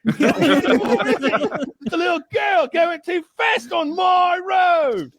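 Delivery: very high, very slow, hammed-up yelling with laughter; mock gloating shout. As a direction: crow over the beaten speeder. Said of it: "the British cop".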